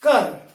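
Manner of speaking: घर turned into कर, no breathy gh; Dogri-style ghar. Shown as a demonstration. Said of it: "इसको हम बोलते हैं".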